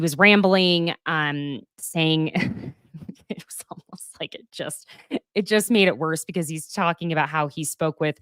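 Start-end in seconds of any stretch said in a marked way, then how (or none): 3.3-4.9 drops to a breathy whisper; soft unvoiced trailing whisper; whispered aside. Real, not acted. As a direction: let it fall to a whisper mid-thought. Trails off mid-thought.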